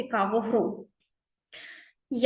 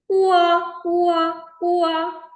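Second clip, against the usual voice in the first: high, loud mock baby wail; crying baby boy; 6 semitones higher, 4 dB louder, much slower than usual. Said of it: "Він кричить навпаки". (високе плаксиве «уа», як малюк плаче)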